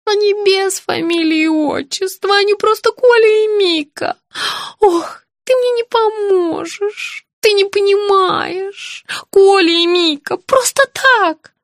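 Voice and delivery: high, drawn-out tearful lament. A narrator's reading. Weeping patient saying nobody understands her, tearful. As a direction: wail it slowly like an upset child. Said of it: "По щекам Евсюковой потекли слёзы".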